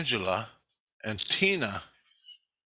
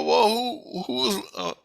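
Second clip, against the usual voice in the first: higher, near-crying retold question; dad begging his daughter for answers; pleading; 10 semitones higher than usual. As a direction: ask it high with a crack of distress. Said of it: "I go".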